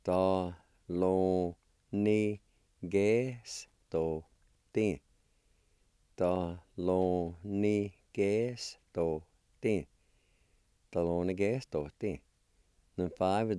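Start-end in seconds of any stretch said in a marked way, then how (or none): 0.1-5.0 very slow, long gaps between syllables; teacher's careful syllable demo. Shown as a demonstration. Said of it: "It's pronounced like this". 6.2-9.9 slow, with shorter gaps between syllables; teacher's drawn-out word demo. Shown as a demonstration. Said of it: "It's pronounced like this".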